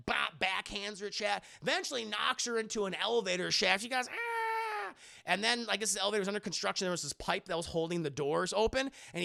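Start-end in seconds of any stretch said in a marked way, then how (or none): 4.1-4.9 mock scream. Retold fight scene, a scream.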